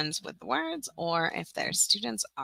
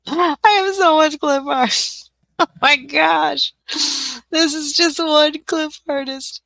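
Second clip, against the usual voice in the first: laughing; 9 semitones higher, 11 dB louder, slower than usual. Real, not acted. Laughing at her huge clip art haul.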